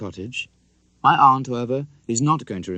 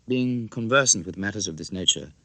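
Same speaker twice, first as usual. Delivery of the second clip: ordinary delivery, nothing marked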